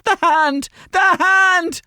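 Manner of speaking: very high, loud mimicked shriek; hammed-up hysterical yell, way up high; hysterical friends. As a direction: squeal it like friends in fits on the phone. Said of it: "they were laughing their head off".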